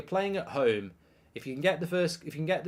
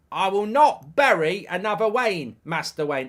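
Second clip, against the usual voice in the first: slow, loud, raised film quote; mock movie declaration; 5 semitones higher, 6 dB louder than usual. Streamer requoting the line for Luke, slow emphatic mock declaration. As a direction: declaim the corrected line, hammed-up.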